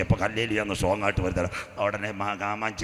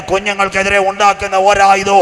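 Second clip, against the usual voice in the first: very loud, high shouted proclamation; preaching cry; 10 semitones higher, 13 dB louder than usual. Acted. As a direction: cry it out over the crowd. Climax of the sermon.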